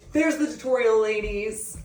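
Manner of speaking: bubbly sign-off. Real, not acted.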